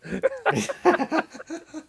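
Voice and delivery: laughs up high. Real, not acted. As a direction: burst out laughing. Laughing at viewer comment.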